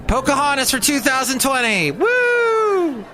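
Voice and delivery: high campaign-rally whoop; mock cheering. Host mock-campaigning for twenty twenty, high cheering.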